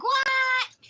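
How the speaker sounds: high, loud shocked yelp; retold horrified what; shocked cry. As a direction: blurt it out in horror. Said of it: "I'm like".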